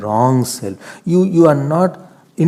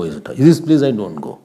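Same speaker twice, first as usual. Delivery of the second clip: ordinary delivery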